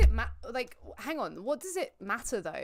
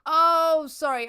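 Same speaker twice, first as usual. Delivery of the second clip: startled oh; 7 semitones higher, 13 dB louder, slower than usual. Real, not acted. Sudden realization.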